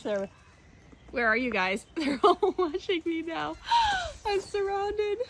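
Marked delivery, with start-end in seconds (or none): 1.9-5.2 high gasp into mock alarm; playful alarm at being surrounded; laughing surprise. Real, not acted. Monkeys all watching her, high gasping alarm.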